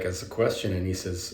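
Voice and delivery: ordinary delivery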